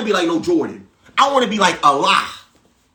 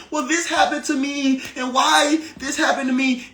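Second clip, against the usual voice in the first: whiny victim; 7 semitones higher, 3 dB quieter than usual. Retold or imitated. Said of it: "being victim of your reality".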